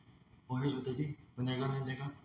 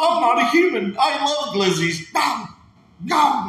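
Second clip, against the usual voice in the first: much higher, louder, slow NPC squeal; grown man doing NPC; 8 semitones higher, 7 dB louder, slower than usual. Retold or imitated. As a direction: ham up the robotic streamer act.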